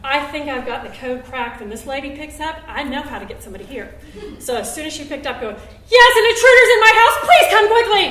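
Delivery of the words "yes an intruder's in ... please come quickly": very high, loud hammed-up panic; urgent plea. Woman to the dispatcher, hammed-up panic. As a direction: oversell the emergency. Faking urgency to get help.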